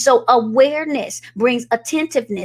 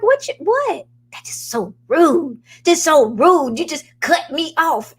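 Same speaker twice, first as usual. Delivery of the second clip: high-pitched mock indignation; offended husband; 6 semitones higher than usual. Wife imitating husband who got cut off, offended and high. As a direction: act out taking offence, pitch lifted. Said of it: "he would be so offended".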